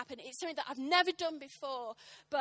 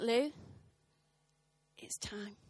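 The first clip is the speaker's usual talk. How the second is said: whispered, weighty summons; retold pastor's soft whisper; hushed urging; 3 semitones lower, 5 dB quieter, slower than usual. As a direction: whisper it like a quiet calling. Retells pastor's solemn call to her.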